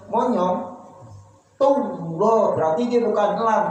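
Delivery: ordinary delivery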